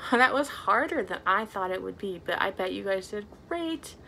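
ordinary talk, nothing marked

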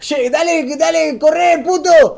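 cargada aguda y fuerte; burla gritada imitada. Retold or imitated. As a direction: imita a los pibes apurándolo a los gritos. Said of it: "me empezaron a descansar". (gritos burlones de pibes)

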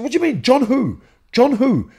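high, insistent demand to know who; retold baffled outburst. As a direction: press him, incredulous and worked up. Confused by the accusation, retold.

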